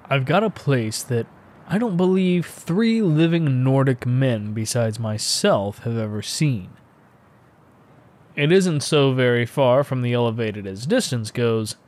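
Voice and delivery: usual speaking voice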